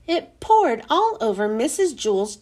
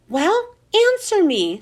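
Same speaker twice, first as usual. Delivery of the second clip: slow, higher-pitched insistent demand; 6 semitones higher, 4 dB louder than usual. A narrator's reading. Teacher presses a silent boy for an answer, demanding. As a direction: demand an answer, slowly and firmly. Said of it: "she demanded".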